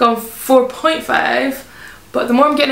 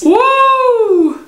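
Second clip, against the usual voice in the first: excited cheer; 11 semitones higher, 4 dB louder than usual. Real, not acted. Cheering as can opens.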